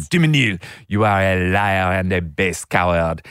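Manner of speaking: put-on loud, declamatory French-accented challenge. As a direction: denounce him in a butchered French accent. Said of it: "I'm gonna continue butchering this accent".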